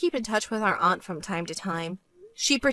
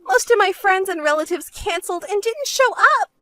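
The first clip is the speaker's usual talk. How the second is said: high-pitched whining complaint; complaining, desperate sister; 9 semitones higher, 5 dB louder than usual. Acted. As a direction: pour out your money woes in a raised voice.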